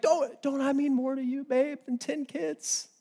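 high, soft mock-sweet husband; dismissive husband. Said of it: "Insensitive. Dismissive".

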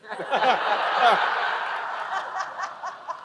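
high burst of laughter at the joke; big real laugh. Real, not acted. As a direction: laugh out freely, high and bright. Punchline of funny story.

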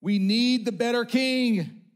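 loud longing call; pleading shout. Acted. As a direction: cry out for a better king. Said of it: "screaming at us".

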